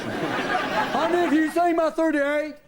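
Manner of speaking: high, loud, slow call; mock husband calling. Husband calling to his wife for his pistol, high and loud. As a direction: call out casually for the gun.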